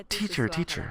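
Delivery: put-on high-pitched kid calling teacher; eager schoolboy. Boy appeals to his teacher.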